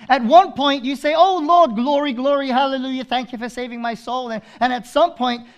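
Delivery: high, loud preaching cry; fervent pulpit voice; full-force preaching. Acted. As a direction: preach it out to the congregation.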